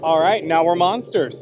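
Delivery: loud slow triumphant announcement; excited. Real, not acted. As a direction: declare it with big energy. Proclaims monster transformation.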